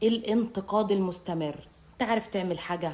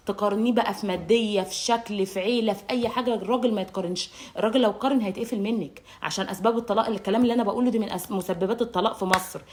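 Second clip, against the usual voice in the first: ordinary talk, nothing marked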